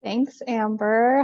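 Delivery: normal talking voice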